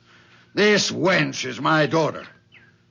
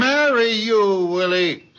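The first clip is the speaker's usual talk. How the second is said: mocking laugh; 6 semitones higher than usual. Father mocking his daughter's marriage hopes. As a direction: laugh at her scornfully.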